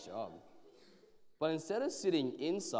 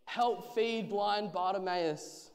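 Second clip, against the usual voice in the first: slow, loud beggar's cry; drawn-out, higher street call; 5 semitones higher, 6 dB louder, slower than usual. A narrator's reading. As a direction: beg from passers-by in a raised voice. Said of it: "rattled his bowl and called out".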